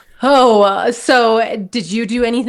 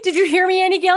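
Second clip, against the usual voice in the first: lightly voiced eager kid, higher pitch; eager child; 8 semitones higher, faster than usual. Child back home asking Annie Gail, eager. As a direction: ask it high, like a hopeful child.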